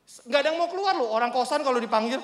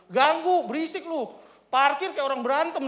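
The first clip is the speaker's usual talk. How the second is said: raised, higher annoyed voice; retold scolding; 5 semitones higher, 4 dB louder than usual. Neighbour telling off the noisy parking man, annoyed. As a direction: tell him off, acted out in retelling.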